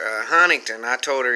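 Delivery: ordinary delivery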